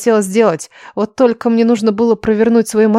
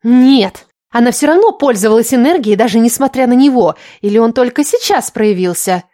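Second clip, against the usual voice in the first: higher, emphatic character voice, arguing; pitched up and pressing the point; agitated schemer Lilya; 3 semitones higher, 4 dB louder than usual. Acted. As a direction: argue insistently as the scheming girl.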